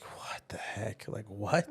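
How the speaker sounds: breathless, whispered disbelief; stunned whisper. Retold or imitated. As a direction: barely voice it, stunned by the news. Stunned reaction to terminal diagnosis.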